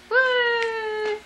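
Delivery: high cheering whoop; excited woo. Real, not acted. Mother cheering her dancing baby, high whoop.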